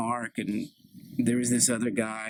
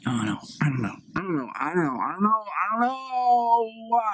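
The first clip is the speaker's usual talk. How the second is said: mock rising wail; 7 semitones higher than usual. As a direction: let the repeated I don't know climb into a hammed-up wail. Daughter melting down, repeating herself.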